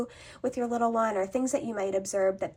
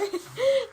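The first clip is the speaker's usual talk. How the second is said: bright laugh. Real, not acted. Laughing at toddler's reply.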